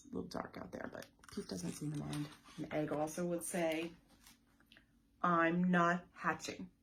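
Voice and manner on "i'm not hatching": slow, louder, dug-in no; loud stubborn egg; loud stubborn refusal. Acted. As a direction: plant your feet and refuse.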